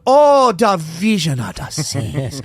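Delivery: put-on slow, dreamy Caribbean island accent. Cruise agent reading his booking in an island accent. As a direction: savor each word in an island lilt. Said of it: "deleted scene from The Little Mermaid".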